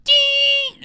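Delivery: very high loud ding imitation; alarm ding. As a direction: ring out like an alarm.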